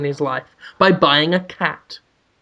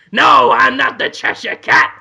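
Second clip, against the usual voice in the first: shouted, high cartoon cat voice; brash talking cat, drawn-out words; Willem Dafoe; 6 semitones higher, 3 dB louder than usual. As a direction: announce yourself like a loud, rude cat.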